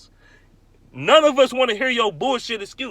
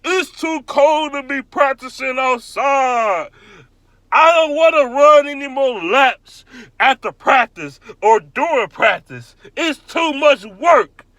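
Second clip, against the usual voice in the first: higher, louder mocking whine, slow; mock whiny shout; 5 semitones higher, 6 dB louder, slower than usual. Fan imitating players griping about practice, loud mock whine. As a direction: ham up a lazy player's complaint. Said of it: "When people be like".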